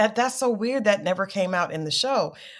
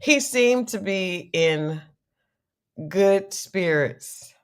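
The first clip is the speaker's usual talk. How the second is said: slowed right down, with pauses; slow, deliberate; slower than usual. Real, not acted. Co-host restating his good spirits after laughing, slow and deliberate. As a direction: restart carefully, word by drawn-out word.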